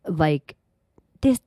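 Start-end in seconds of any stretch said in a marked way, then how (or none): 1.2-1.4 lightly mocking baby voice; mock little girl voice. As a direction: mimic the childish Instagram voice. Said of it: "It doesn't sound like the whole".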